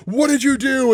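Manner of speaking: high, panicked imitation yell; hammed-up high cry of alarm; mock scream. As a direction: wail it in mock horror. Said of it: "he just starts screaming".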